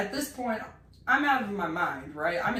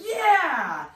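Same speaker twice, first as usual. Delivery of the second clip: much higher, louder cheer; 9 semitones higher, 7 dB louder than usual. Retold or imitated. The crowd agreeing with Jen, a loud cheer. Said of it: "they were like".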